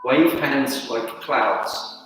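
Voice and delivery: soft, sparse form cues over music; name each move quietly as you flow; calling out moves. Acted. Calls tai chi moves during form.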